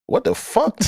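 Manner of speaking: high, baffled cry breaking into laughter; incredulous outburst. Real, not acted. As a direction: burst out in real disbelief. Breaks off reading in disbelief.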